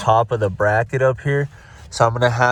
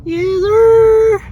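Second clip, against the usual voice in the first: high, loud triumphant yell; excited shout; 10 dB louder than usual. Real, not acted. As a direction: shout it out in victory. Celebrating the part coming free.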